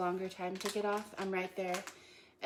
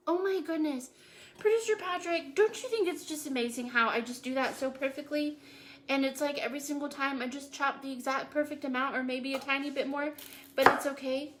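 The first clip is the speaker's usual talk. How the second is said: higher and louder hammed-up self-praise; mock bragging; 5 semitones higher, 6 dB louder than usual. Boasting to producer Patrick about perfect chopping, hammed-up brag. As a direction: show off like a mock-proud chef.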